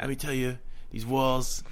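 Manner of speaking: lightly voiced boastful president impression; a hint of the politician, quickly abandoned; Trump. Shown as a demonstration. Reluctant attempt on request, lightly voiced Trump. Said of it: "let me see your Trump impression".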